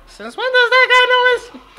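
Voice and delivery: high-pitched disbelief; shocked high outcry. Real, not acted. Shocked by enemy teleport.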